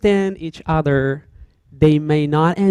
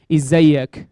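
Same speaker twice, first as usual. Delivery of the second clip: Egyptian greeting; 5 dB louder than usual. Shown as a demonstration. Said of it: "I'm speaking, uh, Egyptian dialect right now".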